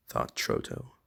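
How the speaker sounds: ordinary delivery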